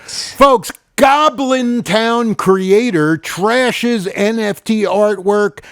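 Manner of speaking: high, loud, hammed-up headline read; over-the-top showman news intro; news announcer. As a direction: boom out the headline, stretching the words.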